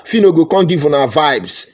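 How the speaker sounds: usual speaking voice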